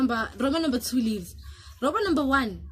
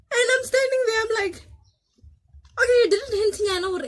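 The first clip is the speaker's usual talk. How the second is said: shocked panic; 12 semitones higher, 7 dB louder than usual. Retold or imitated. Standing alone after friend ran.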